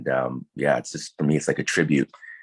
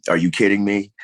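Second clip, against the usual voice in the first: louder, higher repeat of the question; rising disbelief; 4 semitones higher, 5 dB louder than usual. Retold or imitated. Actor retelling the news of landing the role, excited disbelief. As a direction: let the disbelief burst out the second time. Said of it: "and I'm like".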